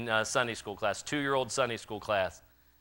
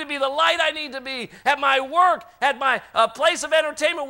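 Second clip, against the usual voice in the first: loud, high preaching that shouts out the regrets; full-force preaching; 10 dB louder than usual. Acted. Preacher confessing to his congregation, shouting at full force. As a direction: preach it hard, close to a shout.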